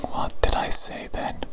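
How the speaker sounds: ordinary talk, nothing marked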